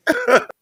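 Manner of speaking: very high giggle; a squeaky burst of laughter. Real, not acted. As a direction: crack up mid-sentence. Laughs before punchline.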